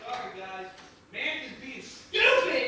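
low, very soft aside; subdued apology. Real, not acted. Streamer apologizes to viewers, low subdued murmur. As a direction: drop to a weary murmur.

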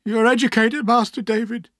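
high-pitched, desperate plea; distraught man appealing for help; sobbing. A narrator's reading. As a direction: keep pleading through the sobs, loud and high. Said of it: "he continued".

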